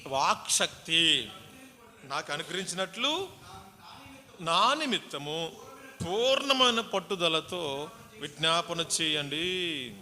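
loud, slow, stretched preaching of the verse; fervent scripture reading. Acted. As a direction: proclaim Paul's plea with power. Reads Paul's plea preached. (గట్టిగా, నెమ్మదిగా వాక్యం చదవడం)

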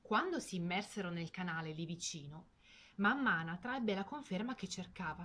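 ordinary delivery, nothing marked

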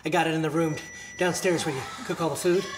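normal talking voice

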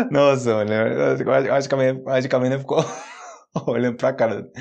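laughing through the words. Real, not acted. Host laughing at a viewer's bread story, laughing. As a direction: laugh while telling it.

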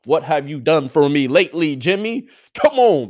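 loud, high demand to Jimmy; loud challenge. Real, not acted. Fan demanding results from the quarterback, loud challenge.

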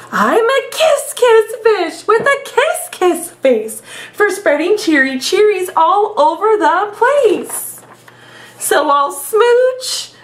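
sing-song the happy refrain like a fish in love; cheery kiss fish voice. Acted. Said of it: "I love doing the silly voices".

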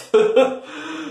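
bright high burst of laughter. Acted. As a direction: cackle high at the comeback. Laughs at the insult.

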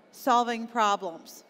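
normal talking voice

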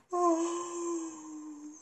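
long weary sigh; tired sigh. Acted. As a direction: sigh like a sleepy bear. Tired bear sighs.

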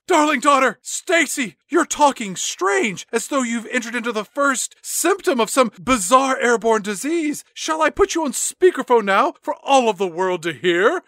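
high, overwrought mother voice; dramatic mom. Acted. Mom scolding her daughter Stacy, high theatrical voice. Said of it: "the mom really dials it up".

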